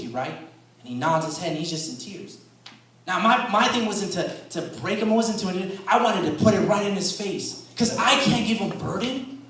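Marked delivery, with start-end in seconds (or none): 3.1-9.4 raised pitch and volume, emphatic; impassioned preaching. Real, not acted. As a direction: preach it with force. Preaches with rising force.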